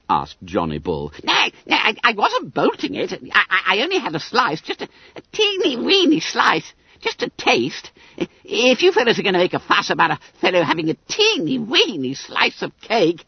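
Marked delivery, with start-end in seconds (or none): 1.2-13.2 very high, stammering excuse; flustered schoolboy Bunter. Acted. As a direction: squeal evasively, tripping over the words. Bunter pleads innocence.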